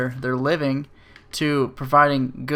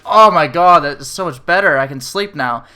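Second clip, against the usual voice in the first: loud, gushing imitation of relief; exaggerated relieved exclamation; mock relief; 7 dB louder than usual. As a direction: burst out as a freshly cracked patient. Acting out a relieved patient.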